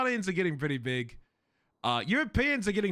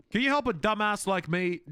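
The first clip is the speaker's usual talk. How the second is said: ordinary talk, nothing marked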